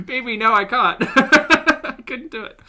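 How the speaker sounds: high, loud, laughing admission of defeat. Real, not acted. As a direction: break up laughing while giving up. Laughing at failed accent attempt.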